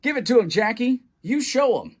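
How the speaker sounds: loud, high cheering; shouting with the crowd. Acted. Boy shouting at the ballgame for Jackie, loud cheer. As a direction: yell it from the stands. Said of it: "Luke shouted with the crowd".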